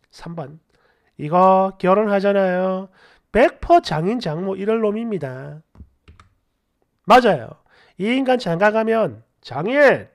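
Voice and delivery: animated story-channel reading; comment read-aloud. Acted. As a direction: read out indignant online replies. Reads listener comments aloud.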